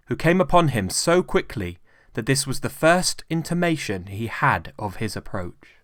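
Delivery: usual speaking voice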